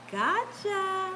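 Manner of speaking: high, drawn-out sly taunt; teasing gotcha. Retold or imitated. Ride lady looking back before take-off, mock-sweet teasing. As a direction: sing it out as a sneaky tease. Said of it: "she was like".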